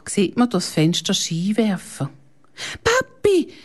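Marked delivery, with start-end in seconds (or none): 2.8-3.5 very high excited yell; a child's joyful shout, pitched way up. A narrator's reading. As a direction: call out to Papi at the door. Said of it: "brüllt der David".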